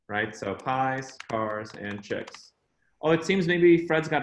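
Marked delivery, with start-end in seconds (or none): 0.5-2.5 "pies, cars, and chicks" slow and spaced out; name memory aid. Shown as a demonstration. Speaker repeats his surname aid for the audience, slowly spacing "pies, cars, and chicks". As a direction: draw out "pies, cars, and chicks", softer toward the end. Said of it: "my last name is pronounced".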